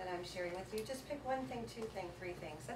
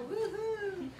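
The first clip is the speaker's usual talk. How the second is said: cheer; 10 semitones higher than usual. Real, not acted. Cheering a step.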